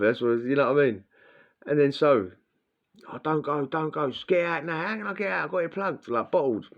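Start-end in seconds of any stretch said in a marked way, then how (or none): 3.1-6.1 high, pleading, imitating a panicking mate; panicky plea. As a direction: mock-desperate squeal, pitched way up. Panicking cellmate quoted in story.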